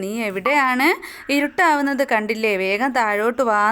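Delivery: usual speaking voice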